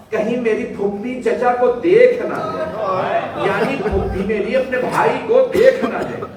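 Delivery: high, loud mourning recitation; impassioned majlis lament. Acted. Preacher recounting Uhud to mourners, full-force wailing lament. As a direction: preach the martyrdom like a rising cry.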